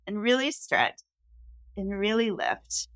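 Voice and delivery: slow, soft instruction to stretch and lift; soft coaching guide. Shown as a demonstration. Coach to client during stretch, softly guiding. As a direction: guide him gently upward. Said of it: "coach guiding a stretch".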